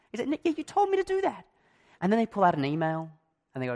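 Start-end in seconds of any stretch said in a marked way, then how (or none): 0.3-1.5 much higher, mocking retort. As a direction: throw it back in a sing-song. Boss retorts in the retold row.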